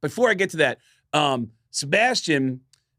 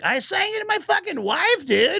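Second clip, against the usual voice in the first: high-pitched rocker impression; mock high squeal of boasting. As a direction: imitate an excitable rock singer, way up high. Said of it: "And he like".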